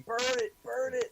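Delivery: high, loud mock panicked yell; imitated yelling. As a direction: yell it like the movie crew. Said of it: "they're yelling at MacReady".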